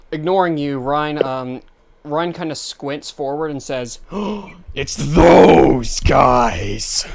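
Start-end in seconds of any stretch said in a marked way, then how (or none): 4.0-4.7 sharp intake of breath; acted gasp. Ryan squints forward and spots the accomplices, gasping. Character spots the other men. 4.7-7.1 loud, very drawn-out mock shout; hammed-up exclamation. Game master as Ryan spotting those guys, hammed-up shouting.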